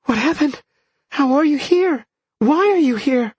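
high, breathless-with-wonder woman's voice; amazed questioning. A narrator's reading. Minnie questions the returned Oak, amazed.

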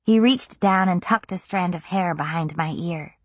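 ordinary delivery

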